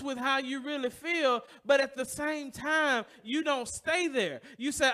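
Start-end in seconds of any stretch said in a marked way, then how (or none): none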